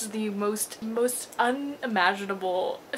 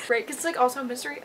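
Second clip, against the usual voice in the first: normal talking voice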